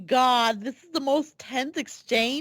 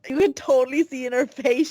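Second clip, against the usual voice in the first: ordinary delivery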